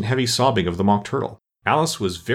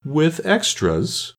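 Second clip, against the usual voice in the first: very slow, higher-pitched anxious question; a little anxious; 7 semitones higher, much slower than usual. A narrator's reading. Mock Turtle asks about Alice's school, a little anxious. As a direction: stretch it out with mild worry. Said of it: "asked the Mock Turtle a little anxiously".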